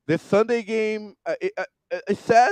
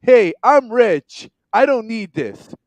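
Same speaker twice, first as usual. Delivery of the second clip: higher, louder, slow smug boast; mock rich brag; 4 semitones higher, 4 dB louder than usual. Summing up the coach to fans, mock smug brag. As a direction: lord it over them, mocking his tone. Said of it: "so basically he said".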